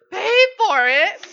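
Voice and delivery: high, very loud, drawn-out yell; exasperated yell. Retold or imitated. Storyteller on grandpa haggling for a mixtape, exasperated yell. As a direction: holler it slowly in comic outrage.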